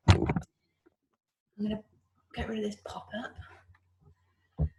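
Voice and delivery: soft slow whisper to herself; whispered aside. Real, not acted. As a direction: whisper it under your breath. Muttered aside while fixing screen.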